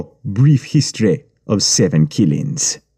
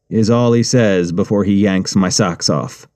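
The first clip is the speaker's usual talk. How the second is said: ordinary delivery, nothing marked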